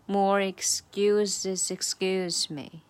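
very slow, sliding the s into excuse; slow demo joining excuses to excuse; slow linked demo. Shown as a demonstration. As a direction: spell out the linking s slowly. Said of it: "就在excuse和excuse，两个excuse中间加了一个S".

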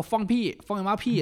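mock-furious wife cutting him off; indignant outburst. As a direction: fire it back, outraged and dismissive. Wife angrily rejects the claim.

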